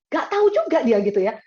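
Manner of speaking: ordinary delivery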